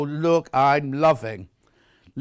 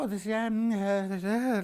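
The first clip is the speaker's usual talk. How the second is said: a softer, half-hearted imitation of polite interest; mock fake enthusiasm; 4 semitones higher, 5 dB quieter than usual. Host imitating herself faking interest in fabric, mock lukewarm. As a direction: pretend to like it without meaning it. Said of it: "like that".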